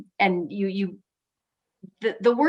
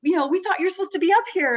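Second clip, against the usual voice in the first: higher and louder, mock surprise; mock surprised officials; 11 semitones higher, 4 dB louder than usual. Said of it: "some of the officials said".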